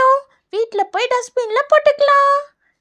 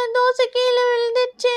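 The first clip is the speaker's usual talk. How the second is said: acted crying. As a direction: wail like an upset schoolchild. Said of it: "யார் அங்க அழுதுட்டு இருக்கிறது?". (அழுகையோடு புலம்பல்)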